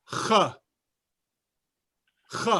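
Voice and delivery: Hebrew ch rasped from the throat; throaty ch. Shown as a demonstration. Teacher demonstrating the Hebrew ch to the class, rasped from the throat. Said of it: "the hardest sound in all of Hebrew".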